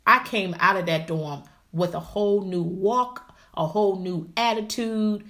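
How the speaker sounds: usual speaking voice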